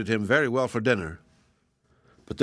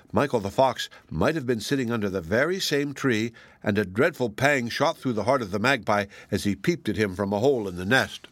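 ordinary delivery, nothing marked

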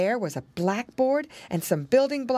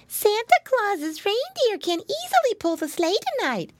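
put-on very high little-girl piglet voice; tiny child's squeak; put-on baby sister voice, pitched way up; Baby Pinky; 14 semitones higher than usual. A narrator's reading. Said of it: "said Baby Pinky".

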